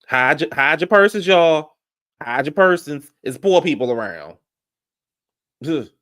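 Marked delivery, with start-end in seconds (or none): 0.1-3.1 louder, higher mocking call to hide purses; mock warning. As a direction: warn the room like it's a joke. Mocking the show's snobbery.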